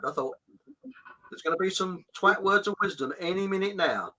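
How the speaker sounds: normal talking voice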